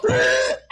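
sharp loud gasp for air; sudden choked gasp. Shown as a demonstration. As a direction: gasp like you're choking. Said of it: "I just go".

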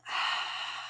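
long soft breathy sigh out; dramatic sigh. Shown as a demonstration. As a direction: let out a quiet, long sigh. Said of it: "breathe out the big sigh".

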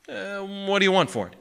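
high-pitched, offhand query; feigned casualness; casual. A narrator's reading. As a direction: ask as if it hardly matters. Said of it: "he said casually".

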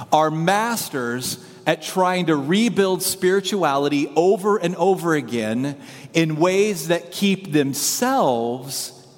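slow, measured statement with long pauses; deliberate delivery. Real, not acted. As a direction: spell out the big idea slowly. States main idea, then repeats it.

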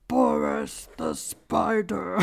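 very deep, slow growl; scary gruff voice. Retold or imitated. Imitating the song's surprise line, deep gruff voice. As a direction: drop it to the bottom and drag it out, growling. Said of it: "scary, gruff voice".

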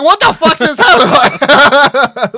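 high, loud and slow through laughter; drawn-out laughing outburst of disbelief. Retold or imitated. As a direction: crack up at how absurd it is. Baffled by the abrupt film ending.

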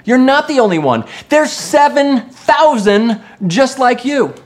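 loud, high preaching declaration; emphatic reassurance. Acted. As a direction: proclaim it forcefully to lift someone up. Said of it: "He was telling him".

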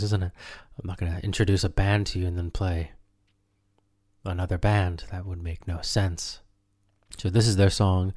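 ordinary delivery

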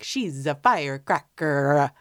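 slow, drawn-out imitation Italian accent. Quoting the husband talking about his wife, mock Italian accent. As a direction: imitate a movie Italian accent, lingering on the words. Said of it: "they all have little Italian accents".

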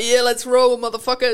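playing the gunslinger for a laugh, loud; Western movie tough guy. At the range in cap and sunnies, mock cowboy bravado. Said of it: "you feel like you're in a Western movie".

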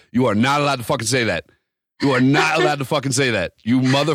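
worked-up mock outrage; retold indignant rebuke. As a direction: re-enact telling off a friend. Acts out berating his friend.

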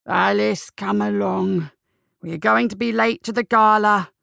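lightly voiced gruff elderly man; gruff old gentleman. A narrator's reading. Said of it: "the low and gruff sound of an elderly gentleman's voice".

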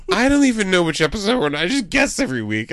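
high and giggly; laughing. Real, not acted. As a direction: blurt it out while laughing. Excited giggling confession.

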